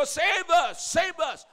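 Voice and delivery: high, loud cry of Save us; desperate shout. Retold or imitated. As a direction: shout it out as the oppressed crowd.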